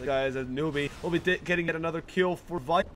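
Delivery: usual speaking voice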